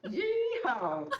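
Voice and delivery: cowboy whoop, high; hammed-up Western yeehaw. Streamer kicking off her horrible Western accent, hammed-up. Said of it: "talking in a really horrible Western accent".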